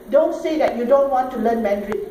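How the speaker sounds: ordinary talk, nothing marked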